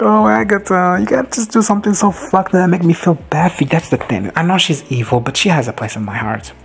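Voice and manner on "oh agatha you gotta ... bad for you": high, pleading cry to the screen; a high, half-joking fan plea; mock pleading. Real, not acted. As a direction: beg the villain like a superfan. Fan pleads with villain character.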